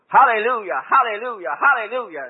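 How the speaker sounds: loud, high, jubilant hallelujahs; joyful shouting. Retold or imitated. She got into it, joyful.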